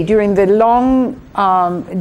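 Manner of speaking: ordinary delivery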